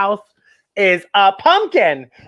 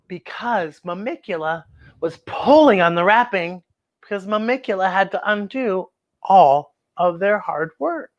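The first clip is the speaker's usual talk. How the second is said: ordinary delivery, nothing marked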